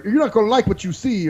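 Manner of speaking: high, pushed imitation of a warning; imitating an indignant hothead; mock threatening guy. Retold threat, same imitated man.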